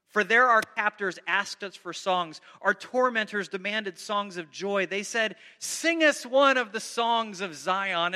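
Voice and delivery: loud, slow psalm read to the congregation; dramatic scripture reading. Acted. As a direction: declaim the lament with weight. Reads psalm aloud with force.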